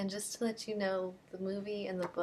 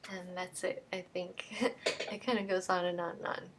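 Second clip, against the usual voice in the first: plain reading voice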